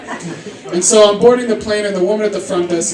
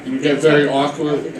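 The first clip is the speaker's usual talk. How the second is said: normal talking voice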